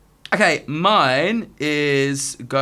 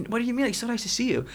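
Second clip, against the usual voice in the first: mock friendly insistence; 9 semitones higher, 3 dB quieter, faster than usual. American stranger acted, insisting.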